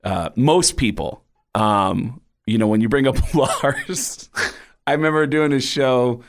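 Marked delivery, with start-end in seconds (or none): none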